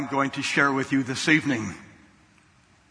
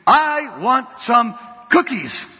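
put-on higher, slow, stretched-out child demand; mock child scream; 6 semitones higher, slower than usual. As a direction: drag out each word like a stubborn kid. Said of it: "he screamed".

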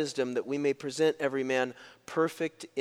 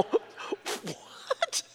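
very high, airy, drawn-out mock question; baffled disbelief; 6 dB quieter than usual. Two friends baffled by the girl's remark, high mock disbelief. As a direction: let it squeak up in comic bewilderment.

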